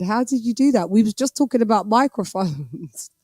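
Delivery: ordinary talk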